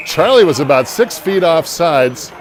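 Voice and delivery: laughing. Real, not acted. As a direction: chuckle out the offside call. Laughing at an offside call.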